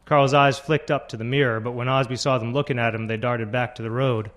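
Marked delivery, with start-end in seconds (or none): none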